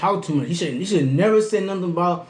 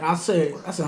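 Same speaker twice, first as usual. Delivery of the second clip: ordinary talk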